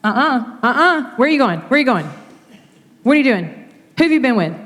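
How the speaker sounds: high, loud rapid-fire mock interrogation; hammed-up freak-out, firing questions after him. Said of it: "freaked out even more and was following him".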